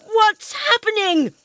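high, slow howl of terror. Acted. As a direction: howl it out in panic. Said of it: "Imogen howled".

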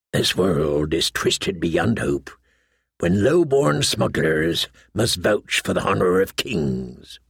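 low, slow inner thought; brooding smuggler. A narrator's reading. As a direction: think it low and slowly to yourself. Voices Davos's private thought.

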